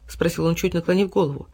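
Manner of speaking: ordinary delivery, nothing marked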